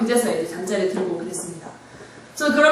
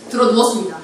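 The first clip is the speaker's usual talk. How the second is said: ordinary talk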